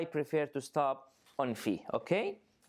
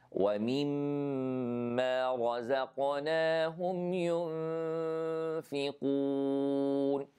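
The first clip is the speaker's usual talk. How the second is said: Quran recitation. Shown as a demonstration. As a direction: recite the verse with measured tajweed. Repeats the ayah recited.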